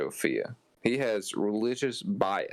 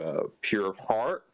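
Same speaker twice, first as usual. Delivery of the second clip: ordinary delivery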